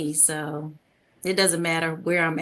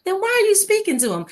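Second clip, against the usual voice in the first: high, louder and quick, mock-exasperated mom; mother's scolding question; 12 semitones higher, 6 dB louder, faster than usual. Mother scolding her daughter for greeting strangers, high and quick. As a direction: fire it off like a baffled mother. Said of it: "She's like".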